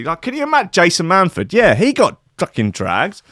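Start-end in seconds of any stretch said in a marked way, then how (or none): none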